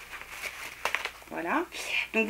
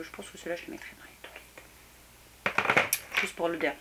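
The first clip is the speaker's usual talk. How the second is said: hushed unvoiced aside; whispering; 3 semitones lower, 6 dB quieter, faster than usual. Real, not acted. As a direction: drop to a whisper. Aside while sniffing candle.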